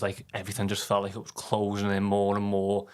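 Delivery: ordinary delivery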